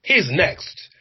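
high-pitched, sure he's next; excited prediction. Retold or imitated. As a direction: announce it with eager certainty. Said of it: "you're like".

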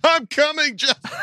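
high falsetto cry through laughter; hammed-up high-pitched heroics; mock rescuer. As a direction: play a fan rushing in, high and giggly. Mock fan rushing to save Justin.

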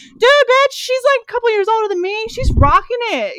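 high, loud burst easing down; emphatic admiration. Retold or imitated. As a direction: hype her up, starting high and loud. Retelling her emphatic admiring reaction.

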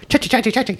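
high, rhythmic cha-cha chant; light, high mock dance noises; imitated dance chant. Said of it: "He was fucking like".

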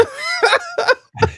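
high delighted laugh; big burst of laughter. Real, not acted. Reacts to the loaded question.

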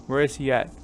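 usual speaking voice

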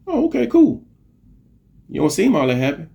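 high, skeptical oh okay cool, then easing down; lightly mock a doubtful cashier; doubtful reply. His retold reply at counter.

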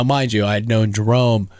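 ordinary talk, nothing marked

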